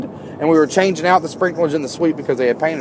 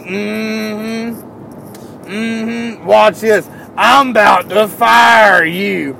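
imitating a gloating boss, higher, slow drawn-out; smug boss; 4 semitones higher, much slower than usual. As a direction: hum it like you've already won. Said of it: "shit-eating grin on his face".

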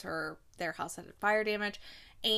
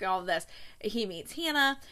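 usual speaking voice

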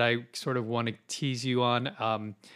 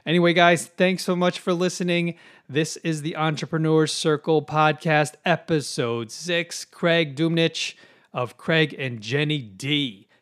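loud, high, bright sign-off to the listeners; excited host signing off the podcast; podcast announcer outro; 5 semitones higher, 7 dB louder than usual. Acted. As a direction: boom it out like a radio host closing the show.

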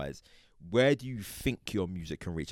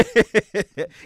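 loud high laugh; sudden loud laugh; burst of laughter; 11 semitones higher, 11 dB louder than usual. Real, not acted. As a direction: laugh out at the question. Laughs at police-style question.

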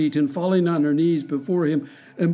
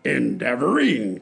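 fierce cry. Acted. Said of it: "cried the tyrant fiercely".

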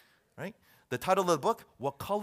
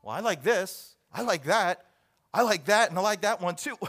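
louder, higher, rising with each item; eager listener; 4 semitones higher, 8 dB louder than usual. Acted. Listener thinking through the list, eagerly liking each option. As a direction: voice a listener keenly picking favorites. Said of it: "and you think".

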